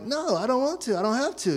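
put-on childish no, stubbornly refusing; whiny refusal. Little brother refusing to clean.

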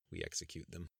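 a softly menacing foreign traveller; cold quiet reply. Acted. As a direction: let it drop quietly and coldly. Mahmoud answers with a faint grin.

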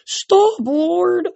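high, pleading horse voice; horse crying out. A narrator's reading. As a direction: cry out loud like a horse begging. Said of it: "a horse cried".

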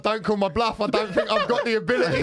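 a hammed-up tough guy cracking up; mock bravado laughing. Mocking the plumber's bluff to friends, laughing.

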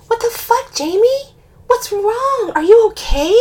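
panicked concern; alarmed. A narrator's reading. Alarmed questions to crying friend.

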